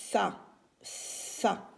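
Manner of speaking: "ça" with a long S before the A; first sound held. Shown as a demonstration. Teacher showing it is letter S, long S in "ça". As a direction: hold the S of "ça", then release the vowel. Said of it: "C'est la lettre S".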